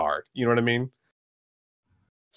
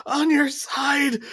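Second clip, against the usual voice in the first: high, drawn-out wailing protest; slow mock-panicked cry; desperate plea; slower than usual. Cyborg pleads it is friendly.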